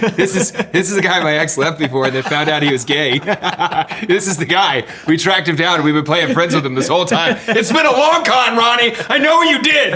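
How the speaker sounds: laughing through a mock accusation; cracking up while teasing. Real, not acted.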